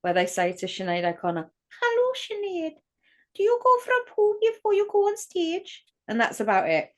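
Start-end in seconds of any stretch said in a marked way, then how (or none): 1.8-5.8 high, put-on Geordie accent; squeaky Geordie impression from a comedy skit; mock Geordie sketch voice. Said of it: "Vic and Bob doing, um, their Sinead skit".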